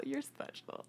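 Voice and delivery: high slurred whisper; drunk friend voice. Retold or imitated. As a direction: coo it like a drunk friend at a bar. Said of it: "Sound like the drunk friend at the bar".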